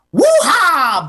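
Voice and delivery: high, loud [name] whoop. Retold or imitated. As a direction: belt it out, hyped. [name] catchphrase shout.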